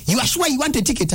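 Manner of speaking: imitated sharp challenge. Officer challenging the driver at the lights, high mock challenge. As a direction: fire it back at him, high and quick. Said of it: "she lowered down her voice".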